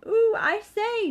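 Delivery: high, loud, slow ooh; excited squeal. A narrator's reading. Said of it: "making noises".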